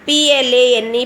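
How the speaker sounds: ordinary delivery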